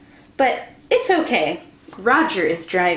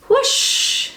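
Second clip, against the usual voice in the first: whoosh noise; 13 semitones higher than usual. Acted. George makes wind noise.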